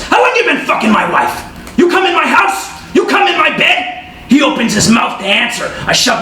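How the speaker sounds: screaming high and loud with rage; husband catching his wife's lover, enraged yell; furious shout. Acted. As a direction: tear into him at full volume.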